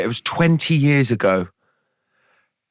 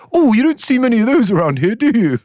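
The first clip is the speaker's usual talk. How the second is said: high, quick frog voice, louder; wide mouth frog; 11 semitones higher, 4 dB louder, faster than usual. Acted. Frog answers the crocodile that eats frogs, high quick voice. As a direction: rattle it off high and fast.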